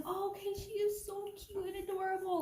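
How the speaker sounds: high, put-on cooing voice; cooing friend. Imitating the friend cooing that the dog is adorable. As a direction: gush like the dog is the cutest thing.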